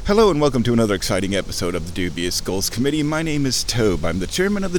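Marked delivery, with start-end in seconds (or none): 0.1-3.0 rushed show host intro; fast intro. Acted. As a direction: rattle off the welcome quickly. Rapid formal intro.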